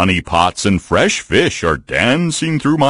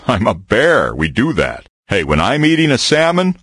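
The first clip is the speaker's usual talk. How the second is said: ordinary talk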